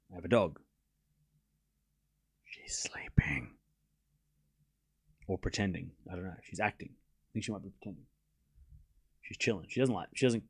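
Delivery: hushed, unvoiced whisper so as not to wake her. Real, not acted. Streamer telling chat about sleeping dog, whispered. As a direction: whisper softly, the dog is asleep.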